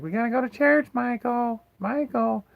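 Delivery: high, sing-song mock mom calling upstairs; mocking his mom. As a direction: mimic a fussy churchgoing mom calling her son. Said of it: "his mom would be like".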